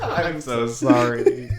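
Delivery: slow drawn-out sorry through laughter; stretched, giggling sorry; laughing apology. Real, not acted. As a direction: apologise while cracking up. Laughing apology after roast.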